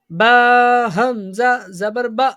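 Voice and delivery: chanted spelling ending in "با" cut short with a glottal jerk; jerk on hamza. Shown as a demonstration. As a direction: draw out the letters, then stop "با" abruptly on the hamza. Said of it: "حمزہ ساکن کو ہمیشہ جھٹکا دے کے پڑھتے ہیں". (ہمزہ ساکن پر جھٹکے سے "با")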